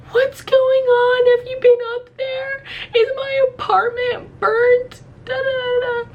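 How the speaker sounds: hammed-up squealing sobby pleading; mock crying. Questioning every single fireman, high mock wailing. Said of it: "I'm crying to these firemen".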